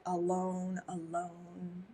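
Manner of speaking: mock-dramatic fading alone; lonely echo. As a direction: draw out "alone" like a mournful movie line. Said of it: "I love Renee Zellweger".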